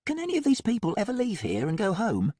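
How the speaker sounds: doubtful, uneasy question; Molly voice. A narrator's reading. Molly asks, disturbed.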